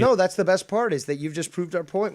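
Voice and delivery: eager loud cut-in; excited outburst. Real, not acted. Excited interjection in debate.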